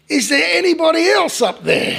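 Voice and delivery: loud, very high call for help; acted shout. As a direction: holler desperately for someone else. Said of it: "the man shouted out".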